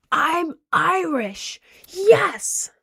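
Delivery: high, drawn-out cheer; mock joy. Retelling her ancestry result, cheering high. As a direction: celebrate like a thrilled kid. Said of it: "And I was like".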